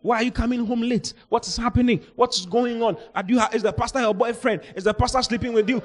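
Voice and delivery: high, nagging family member interrogating; high mock accusing parent. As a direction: mimic suspicious relatives grilling her. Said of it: "They will start persecuting you".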